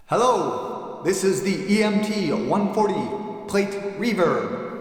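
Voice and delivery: plate reverb effect. Shown as a demonstration. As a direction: voice washed in a bright metal plate reverb. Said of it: "It sounds like this".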